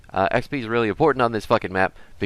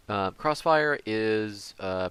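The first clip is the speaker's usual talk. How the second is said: ordinary talk, nothing marked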